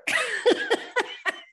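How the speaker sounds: loud high giggle; real bright laugh; high burst of laughter. Real, not acted. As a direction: laugh at the awkward memory. Laughs at her own flirting.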